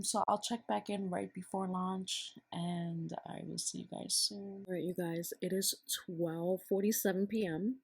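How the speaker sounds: normal talking voice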